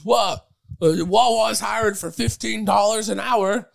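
high, loud drawn-out exclamation; hammed-up excited announcement; mock excited. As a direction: play up the job news, loud and slow. Acted couple's exchange in a story.

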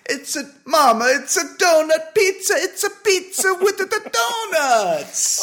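put-on very high, loud Italian accent; jolly Italian pizza chef. Mock Italian ad continues.

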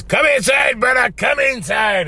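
high, drawn-out shout; imitated brusque call. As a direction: call someone in from across the room. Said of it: "He, he's like that".

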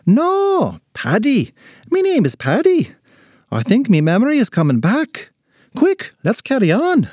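high, excited leprechaun voice; Paddy. Acted. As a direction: insist on it as memory returns.

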